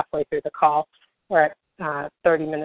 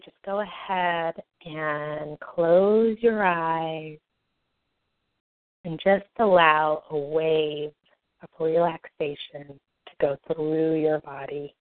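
slow, soft soothing meditation voice; guided meditation; 3 dB quieter, slower than usual. Acted. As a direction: ease them gently into calm.

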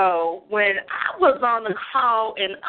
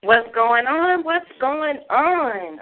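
drawn-out greeting; 3 semitones higher, much slower than usual. Real, not acted. Caller greets the show hosts.

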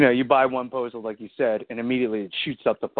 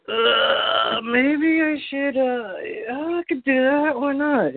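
high, slow talked-into-it voice; high hesitant voice; 12 semitones higher, much slower than usual. Retold or imitated. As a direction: talk yourself into it, up high. Imitating tempted buyer.

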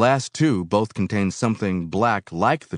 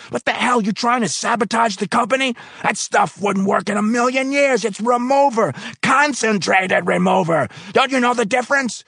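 shout; 6 dB louder than usual. Acted. Foreman shouting at the worker about the wrong tank. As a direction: blast the mistake at him. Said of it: "he shouted".

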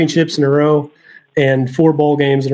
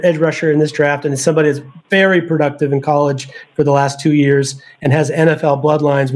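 usual speaking voice

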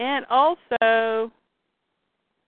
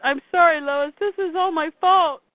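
raised, higher-pitched apology; distraught apology; 5 semitones higher, 4 dB louder than usual. Retold or imitated. Retelling Clark apologizing to injured Lois, distraught apology. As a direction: apologize urgently over screaming. Retold hero apologizing amid screams.

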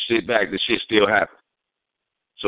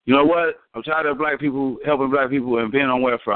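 president voice; 6 semitones higher than usual. Retold or imitated. Voicing the president in story.

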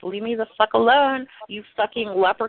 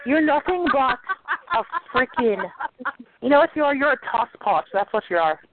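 higher, put-on Irish brogue; mock Irish insult; 3 semitones higher than usual. Teasing Josh in a put-on Irish brogue. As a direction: jab him in a hammed-up Irish brogue. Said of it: "an old Irish ditty".

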